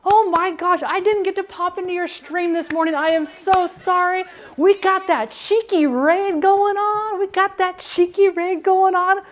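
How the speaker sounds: high, loud, gushing thanks; excited welcome. Real, not acted. Streamer thanking raiding chef's family, high and gushing. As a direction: bubble over welcoming the raid.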